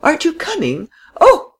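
rising alarm, breaking into a cry; dismayed cry. A narrator's reading. Lady on the departing tram, crying out in alarm. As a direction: pitch leaps very high to a loud Oh.

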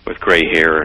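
ordinary talk, nothing marked

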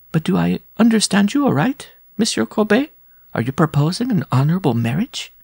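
high, lilting woman's voice; giantess questioning. A narrator's reading. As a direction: ask with exaggerated ladylike interest. Giantess questions the proposal with mock seriousness.

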